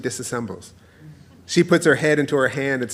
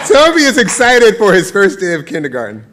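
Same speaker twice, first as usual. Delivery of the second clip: excited; 5 semitones higher, 9 dB louder than usual. Shown as a demonstration. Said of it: "I'm gonna have to stop anyway".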